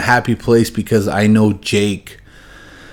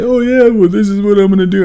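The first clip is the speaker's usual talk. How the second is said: much higher, goofy know-it-all voice; mocking YouTube guy; 11 semitones higher, faster than usual. Mocking clueless YouTube car guys in a high goofy voice. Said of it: "I just kinda making fun of him".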